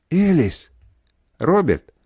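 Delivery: loud cries of each name; near-screaming cries. A narrator's reading. Family crying out for each other, loud shouts. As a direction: shout the names in alarm. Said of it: "Они кричали, едва ли не визжали".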